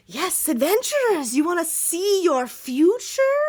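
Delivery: high, slow mystic fortune teller. Acted. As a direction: lure them in like a carnival seer. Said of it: "she's like".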